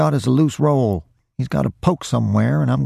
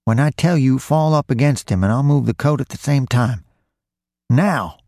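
higher, urgent thief giving orders; insistent instructions; 6 semitones higher than usual. A narrator's reading. Roy tells his partner how to get the coat, insistent. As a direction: press a nervous partner, pitched up.

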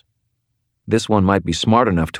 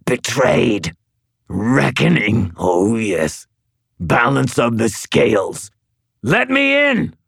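high, frantic hissing ghoul; breathy hiss through teeth; 6 semitones higher, slower than usual. Acted. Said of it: "the ghoul snarled".

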